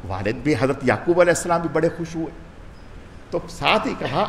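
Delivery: normal talking voice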